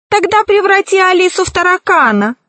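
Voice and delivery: high, quick eager demand; crying out. A narrator's reading. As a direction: call out the order, high and fast. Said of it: "закричал весельчак У".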